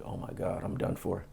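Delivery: very quiet, under the breath; hushed inner voice; retold private dread. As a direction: murmur it to yourself, defeated. Retells his silent dread in court.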